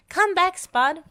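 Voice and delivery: high, loud call after him. A narrator's reading. Wendy calls after the fleeing Spud, loud. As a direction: call him back across the yard. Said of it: "called Wendy".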